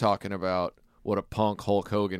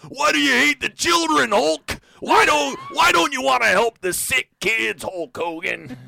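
high shouting wrestler rant; Randy Savage yelling; 8 dB louder than usual. Retold or imitated. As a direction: scream at Hulk like Macho Man. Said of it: "He's like".

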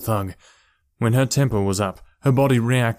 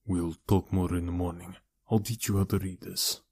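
low, soft reassuring voice; quiet, measured older relative; calm, guarded uncle; 3 semitones lower, 8 dB quieter than usual. A narrator's reading. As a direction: gently put it off till morning.

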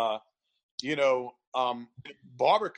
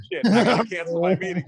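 mock panicked outburst; 3 semitones higher, 8 dB louder than usual. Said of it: "I was like".

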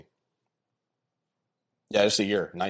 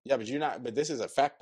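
usual speaking voice

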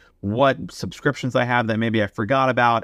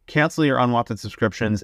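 usual speaking voice

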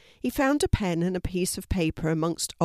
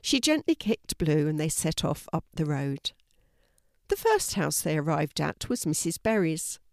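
plain reading voice

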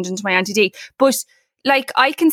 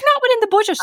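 sing-song high refusal, much higher; playfully prim, pitched way up; mock firm refusal; 13 semitones higher, faster than usual. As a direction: sarcastically chirp the rule back at him. Wife refusing husband's bike spending.

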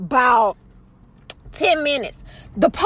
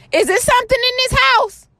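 daughter voice; 11 semitones higher than usual. Retold or imitated. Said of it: "She was like".

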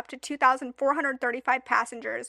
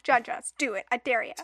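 ordinary delivery

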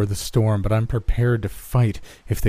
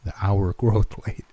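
ordinary delivery, nothing marked

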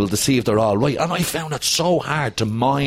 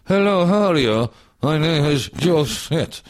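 high, slow, stiff overacted line delivery; stilted like a stroke, slowed right down; stiff overacting; 5 semitones higher, slower than usual. Actor retelling his stiff first take, overacted and slow. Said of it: "looked like I'd had a stroke".

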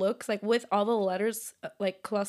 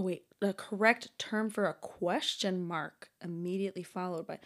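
normal talking voice

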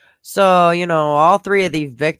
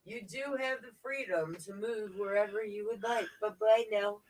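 muffled mic, far softer; 7 semitones higher, 16 dB quieter than usual. Real, not acted. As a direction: voice dulled by a bad microphone. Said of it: "It sounds like it's muffled".

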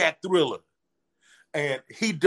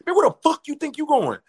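tough bodyguard; 11 semitones higher than usual. Retold or imitated. Bodyguard stopping him at the dressing room, mock tough. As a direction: bark it like a bouncer blocking the door.

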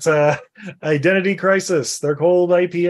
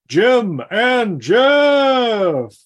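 drawn-out announcing; 4 semitones higher, 4 dB louder, much slower than usual. Acted.

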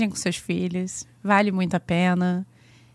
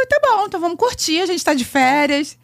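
cheerful self quote; 8 semitones higher than usual. Retold or imitated. Self quoted going on holiday.

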